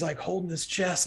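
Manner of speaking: normal talking voice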